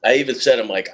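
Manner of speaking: ordinary delivery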